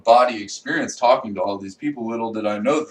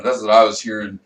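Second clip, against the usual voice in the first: ordinary talk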